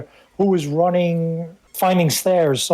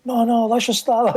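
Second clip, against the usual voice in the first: pleading Italian mom; 6 semitones higher than usual. Retold or imitated. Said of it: "she's saying it in Italian".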